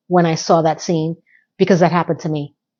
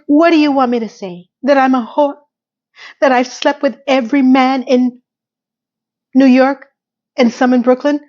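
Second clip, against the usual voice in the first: fast, pitched-up imitation of a frantic plea; mock desperate outburst; 5 semitones higher than usual. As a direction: rattle it off like a cornered diva. Acting Samantha's desperate outburst.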